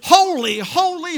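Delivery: high, ringing cry of praise. Acted. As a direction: call it out high like a seraph. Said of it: "one cried unto another and said".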